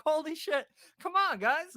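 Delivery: high, loud burst of amazement; retold excitement. Teller calls his buddies into an unlocked garage, excited. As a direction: re-enact an excited call to friends.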